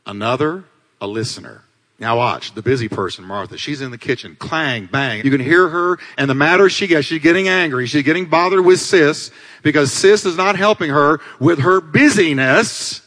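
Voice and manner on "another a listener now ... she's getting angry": normal talking voice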